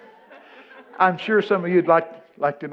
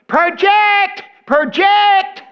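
much higher, louder shrill teacher yell; hammed-up teacher screaming project; mock teacher scream; 10 dB louder, much slower than usual. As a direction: bellow it like a tiny drama teacher from the corner. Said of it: "That's all I ever heard her screaming".

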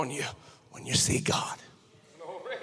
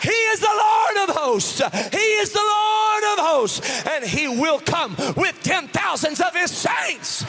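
roaring sermon; 6 semitones higher than usual. Acted. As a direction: preach it at full force. Preaching at full force.

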